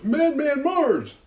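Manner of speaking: high, loud, slow chanted yell; shouting Madman Mars over and over; yelling the name. Retold or imitated. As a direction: bellow it at the house, drawn out. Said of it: "just starts yelling".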